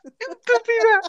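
high laughing yell; laughing shout. Real, not acted. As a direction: yell it while cracking up. Laughing insult at friend.

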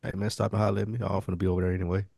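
ordinary talk

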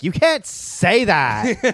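high, slow, put-on indignant voice; mock offended voice. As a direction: stretch it out like a scandalised friend.

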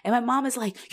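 ordinary talk

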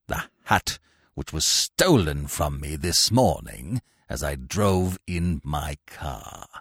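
low, slow icy accusation; deep, deliberate, nasty grandee; cold, nasty. A narrator's reading. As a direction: accuse him coldly in a deep, measured voice. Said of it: "nastiest voice I'd ever heard".

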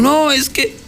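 mock-sob it out as the beaten grandmother; a touch of a weepy grandmother; crying old lady. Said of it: "la pobre señora llorando".

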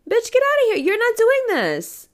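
high, loud yell at the bully; outraged high-pitched yell; mock yell. Confronting imagined bully.

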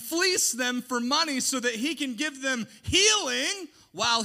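loud, high indignant sermon; preaching full force. Acted. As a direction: rail against false teachers.

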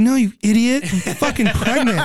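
retold exasperation. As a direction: imitate scolding a clueless husband. Retelling wife's exasperated correction.

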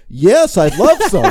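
loud, slow, overjoyed and laughing; hammed-up delighted yes; too eager. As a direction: gush way too loudly. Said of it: "You can't just be like".

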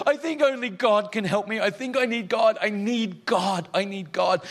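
high, pleading voice of a broken woman; distressed young woman. Retold or imitated. Pastor voicing the goth woman's confession, high and pleading. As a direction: plead high and raw, near tears. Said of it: "And she goes".

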